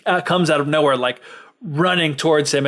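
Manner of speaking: ordinary talk, nothing marked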